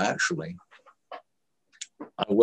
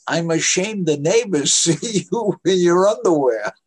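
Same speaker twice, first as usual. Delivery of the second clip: higher, louder imitation of a scolding mom; scolding mother; 8 semitones higher, 6 dB louder than usual. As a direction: play an ashamed mother telling off her son. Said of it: "my mother said to me".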